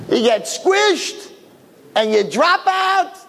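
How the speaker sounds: high, drawn-out sarcasm; mocking retort. Doubting twin answering his hopeful brother, high mocking retort. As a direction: stretch it high to mock a naive idea.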